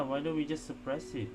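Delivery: usual speaking voice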